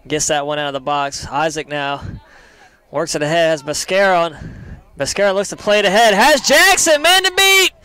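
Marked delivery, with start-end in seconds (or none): 5.0-7.7 quick start rocketing into a high, loud shout; building excited call. Real, not acted. Commentator calling Jackson's chance with one man to beat, excitement building to a shout. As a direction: let the excitement explode as the breakaway opens.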